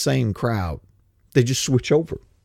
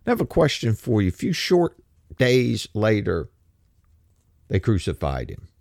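ordinary talk, nothing marked

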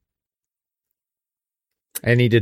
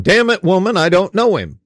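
high, louder hammed-up cussing snap; mock profane outburst; 8 semitones higher, 5 dB louder than usual. Preacher imagining Peter swearing at the servant girl, hammed-up. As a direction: play a crude cursing denial for laughs. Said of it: "Wasn't Jesus going".

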